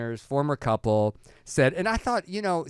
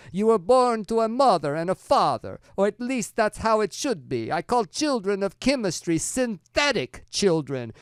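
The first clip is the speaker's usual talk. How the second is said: higher, louder mock Italian Father Guido Sarducci accent; a comic Father Guido Sarducci Italian accent, higher and louder; 7 semitones higher, 6 dB louder than usual. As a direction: read the quote in a hammed-up Italian priest accent. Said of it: "it's a Father Guido Sarducci accent".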